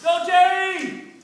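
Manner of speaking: high, loud, drawn-out cheer from a passing car; cheering yell. A narrator's reading. A passer-by cheering Terry on from a car, loud and high. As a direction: shout it out the window. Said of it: "yelling encouragement out their windows".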